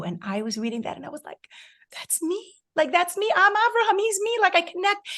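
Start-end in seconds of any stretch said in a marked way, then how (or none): 1.9-5.1 excited recognition. Retold or imitated.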